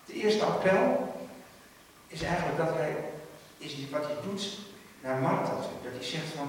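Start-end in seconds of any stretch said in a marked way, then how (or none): none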